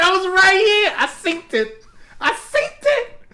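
high-pitched retold insistence; exasperated protest. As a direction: insist in a high, comically aggrieved voice. Retold protest to his mom.

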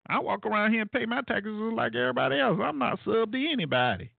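mock outrage at being called a subgroup; mock indignation. Acting offended over being called subgroup.

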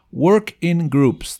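slow teacher demo; slow model reading. Shown as a demonstration. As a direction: Work in Groups said slowly, each word drawn out. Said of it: "trabajen en grupos".

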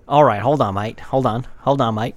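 put-on Australian bloke voice; Jack Wallaby, Australian accent. Introducing his made-up character, Australian accent. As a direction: play a chummy Australian character. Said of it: "Australian guy".

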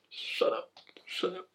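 laughing, breathy whisper; whispered shut up. Pretend. Host teasing herself over a word, laughing whisper.

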